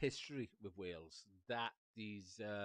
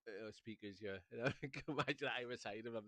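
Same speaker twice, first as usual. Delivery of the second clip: ordinary talk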